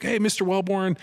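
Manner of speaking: high-pitched imitation of eager kids; neighborhood kids' greeting. Kids greeting the NFL neighbor, high imitated voice.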